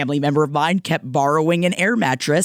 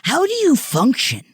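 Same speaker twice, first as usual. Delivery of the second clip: pitched much higher, baffled; mock-baffled question to a messy friend; exasperated disbelief; 11 semitones higher than usual. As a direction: ask it like you can't believe them. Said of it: "that I'm like".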